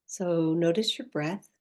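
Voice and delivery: soft, unhurried meditation voice with long pauses; guided meditation. Acted. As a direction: gently guide the listener inward, leaving space after each phrase.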